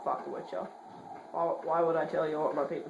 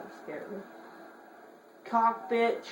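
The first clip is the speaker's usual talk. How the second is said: normal talking voice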